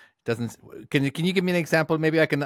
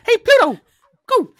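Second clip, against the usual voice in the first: very high, loud squeaky call to the dog; mock Mickey calling Pluto; Mickey Mouse voice; 10 dB louder than usual. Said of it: "I'm like".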